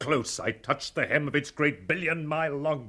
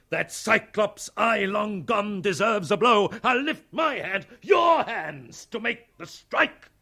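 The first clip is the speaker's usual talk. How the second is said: higher and louder, building to a battle cry; rousing shout; 7 semitones higher, 4 dB louder than usual. Acted. Captain rallying his men against Leviathan, shouting. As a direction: whip the crew into a frenzy. Captain rallies the crew to strike.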